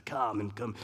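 lightly voiced creepy villain, pitched low; a hint of villain, low and understated. Preacher imitating Herod luring the wise men, lightly creepy villain. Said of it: "the creepy villain voice there".

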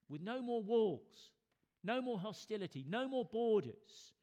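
loud, high, drawn-out preaching cries; rousing proclamation. Real, not acted. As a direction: hammer out each phrase to the congregation. Climax of sermon, rhetorical triple.

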